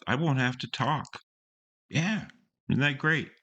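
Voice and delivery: high-pitched mock enthusiasm; mock-upbeat. Host mockingly paraphrasing the song's odd logic, mock-upbeat. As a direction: play up the silly bright side.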